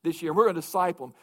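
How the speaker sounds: rising pulpit fervor, pressing the congregation; preaching at full force. Acted. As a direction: hammer the call home from the pulpit. Preaching the call to devotion.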